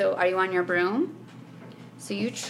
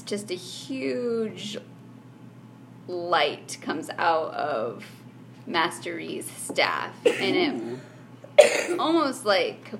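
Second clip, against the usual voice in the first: slow dramatic narration; 2 semitones lower, much slower than usual. Acted. Game master scene narration.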